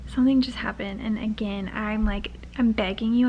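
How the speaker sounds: ordinary talk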